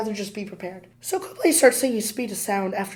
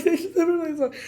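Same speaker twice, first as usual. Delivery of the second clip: hysterical laughing; 8 semitones higher, faster than usual. Retold or imitated. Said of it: "Okay, I'm hysterical".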